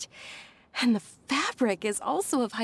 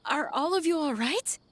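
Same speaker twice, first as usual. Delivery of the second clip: excited greeting; 7 semitones higher than usual. Acted. Friends return after long absence.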